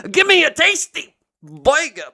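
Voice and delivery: high, shouted New York burger order; intense mock begging. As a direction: demand it at full force in a mock New York accent. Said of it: "with that intensity".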